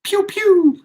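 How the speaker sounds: high, loud pew pew; gun sound effect. Pretend. Streamer mimics gunfire at a chatter, high and playful.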